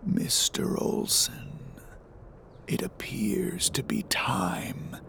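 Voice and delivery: slow, low deathly whisper; dark whisper behind you. Acted. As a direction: whisper like a voice from a tomb. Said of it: "a low, deathly voice in the darkness, like a whisper in a mausoleum".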